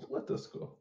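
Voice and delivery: small whispered echo of the rallying cry. Acted. Player echoing the rally call, whispered. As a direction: breathe it out as a timid whisper.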